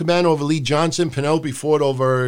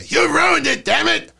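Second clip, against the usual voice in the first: angry Vince shout; 12 semitones higher than usual. Retold or imitated. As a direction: scream it like a furious boss.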